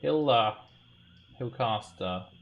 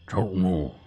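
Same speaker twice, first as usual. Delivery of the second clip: gruff voice; 8 dB louder than usual. Acted. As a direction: growl the warning like a thug. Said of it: "It sounds like a pretty gruff voice".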